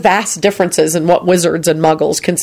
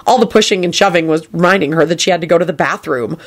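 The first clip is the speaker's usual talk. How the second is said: usual speaking voice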